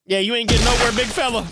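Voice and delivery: ordinary delivery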